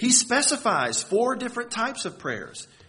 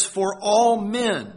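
slow and louder, leaning on all; emphatic scripture quote; 3 semitones higher, 10 dB louder, much slower than usual. Real, not acted. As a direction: weigh each word, stressing "all". Quoting the verse emphatically.

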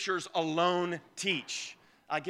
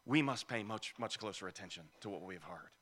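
soft summary; 8 semitones lower, 11 dB quieter than usual. Shown as a demonstration. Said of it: "rephrase like this".

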